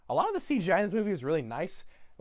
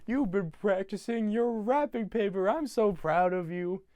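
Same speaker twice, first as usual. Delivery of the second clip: higher, slow, drawn-out doting imitation; proud father; 5 semitones higher, slower than usual. Dad finding Cindy all wrapped up, slow gushing voice. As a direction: coo with exaggerated fatherly pride. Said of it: "and he goes".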